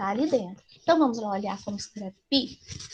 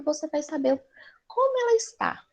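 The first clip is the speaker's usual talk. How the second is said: normal talking voice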